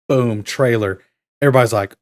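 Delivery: usual speaking voice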